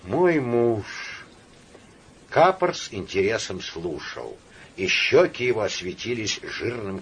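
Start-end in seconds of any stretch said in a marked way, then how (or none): 0.0-0.9 sighing. Acted. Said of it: "вздохнувши".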